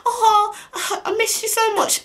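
very high, hammed-up lovesick squeal; mock gushing. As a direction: pretend to adore the caller, sarcastically.

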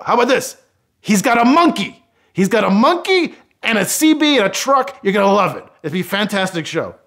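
loud, high-pitched hard sell; excited TV pitch. Retold or imitated. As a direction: pitch a show with escalating, over-the-top enthusiasm. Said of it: "we started doing imaginary pitches".

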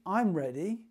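ordinary talk, nothing marked

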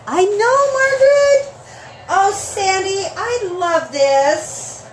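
overjoyed. Real, not acted. Host thanking Sandy for the gift, overjoyed. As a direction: gush over the gift.

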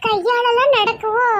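high, drawn-out exclamation; dismayed onlooker. Acted. Onlooker sees three children on hands, dismayed. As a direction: lament in a high, slow voice.

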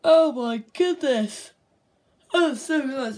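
high, loud, slow exclamation; dismayed awe. Real, not acted. Gamer groaning at a close miss, high and slow. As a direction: moan at the near miss.

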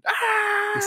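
long, very high, drawn-out mock scream; horror scream. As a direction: scream as the balloon turns red. Balloon turns into Pennywise.

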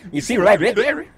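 goofy altered voice, rising end; joking put-on voice. Shown as a demonstration. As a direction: put on a silly voice for the pun.

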